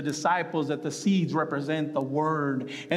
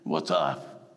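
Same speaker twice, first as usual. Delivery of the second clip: very low, gruff big-guy voice; burly Peter; 13 semitones lower than usual. Acted. Imagining bearded Peter for his pastor, burly voice. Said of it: "big burly guy with a beard".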